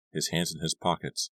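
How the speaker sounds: plain reading voice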